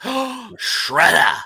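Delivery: high, slow mock shock at Shredder; mock gasp. Podcaster imitating a turtle gasping at Shredder, slow and high.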